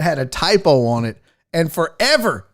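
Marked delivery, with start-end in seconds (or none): none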